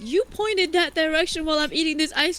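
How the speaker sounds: high indignant protest; indignant squeak. Retold or imitated. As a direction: squeak back in mock outrage. Indignant retort about the stain.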